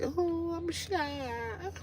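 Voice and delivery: high soft squeaky shy voice; mock shy. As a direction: play coy and bashful. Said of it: "or I could be like".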